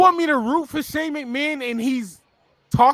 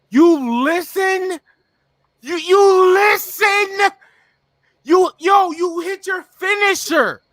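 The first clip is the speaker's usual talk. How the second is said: exasperated yell; 7 semitones higher than usual. Real, not acted. Fan yelling at Braun about his finisher, exasperated.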